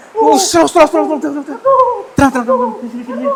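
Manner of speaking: very high, loud panicked repeats; frantic pleading. Real, not acted. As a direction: beg it to stop, half laughing. Panicked calming in the dark.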